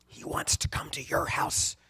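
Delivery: voiceless conspiratorial whisper. Retold or imitated. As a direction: whisper the bad news secretively. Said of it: "Why are you whispering".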